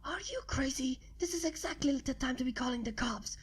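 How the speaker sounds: frantic whisper; hissed whisper. A narrator's reading. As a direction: whisper it in a panic. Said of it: "returned my hissed whisper".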